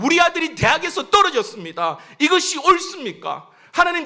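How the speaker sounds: loud, high-pitched impassioned appeal; anguished protest to God. Acted. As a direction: cry out to God, demanding an answer. Acted anguished complaints to God.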